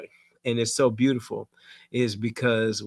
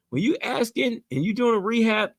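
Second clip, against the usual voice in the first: usual speaking voice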